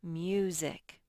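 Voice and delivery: usual speaking voice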